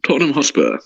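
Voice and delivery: high first team, falling to the final score; rolling results-reader inflection; football results announcer. Retold or imitated. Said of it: "the inflection on the voice".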